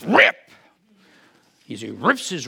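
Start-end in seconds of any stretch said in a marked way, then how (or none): 0.0-0.3 loud, high mimicked rip of cloth; tearing sound. Preacher imitating Caiaphas tearing his robes, loud rip. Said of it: "and he goes".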